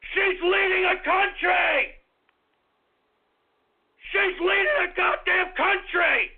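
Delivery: high, outraged yelling; pissed-off rant. Real, not acted. Host berating a country's leader, furious high shouting. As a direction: yell it, utterly incredulous. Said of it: "This freaking subject makes me pissed off".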